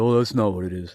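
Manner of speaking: a mildly mocking, dismissive put-on voice; mocking shills. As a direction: sneer it lightly like a know-it-all troll. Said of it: "these idiot shills on here".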